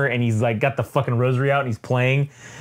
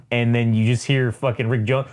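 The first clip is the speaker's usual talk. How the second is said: ordinary delivery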